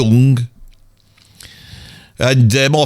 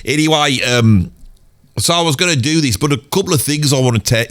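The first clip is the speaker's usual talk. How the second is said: usual speaking voice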